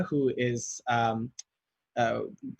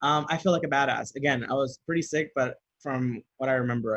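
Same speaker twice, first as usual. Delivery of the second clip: ordinary talk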